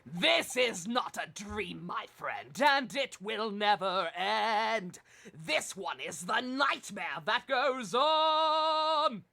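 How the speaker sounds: very slow, booming villain proclamation; ominous shout. Acted. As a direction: drag it out and roar it. Dramatic ominous villain line.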